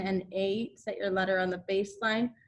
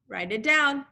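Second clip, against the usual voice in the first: ordinary talk, nothing marked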